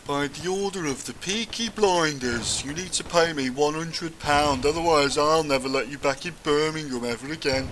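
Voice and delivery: hammed-up Birmingham gang boss laying down terms; Peaky Blinder gangster. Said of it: "pretends he's a Peaky Blinder".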